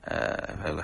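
usual speaking voice

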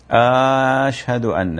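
"أشهد" with a long drawn opening vowel, "aashhadu", slow; drawn-out first vowel of أشهد; muezzin's error, a questioning آشهد; stretched ashhadu. Shown as a demonstration. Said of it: "المد في أول أشهد".

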